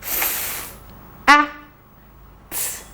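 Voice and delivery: segmenting F, A, T; "F" hissed, then "A", then a soft "T"; sounds said apart. Shown as a demonstration. Teacher sounds out the word for the class, one sound at a time. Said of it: "What are the three sounds that you see?".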